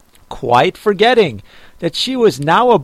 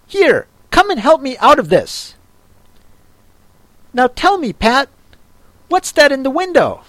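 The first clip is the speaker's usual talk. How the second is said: higher, fussy master's voice; bossy Rabbit; 8 semitones higher, faster than usual. A narrator's reading. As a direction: order the servant about. Rabbit ordering Pat.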